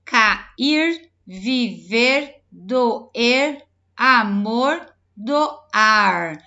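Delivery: loud list stressing the last r; final R demo. Shown as a demonstration. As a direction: make each final r heard. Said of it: "E o R no final".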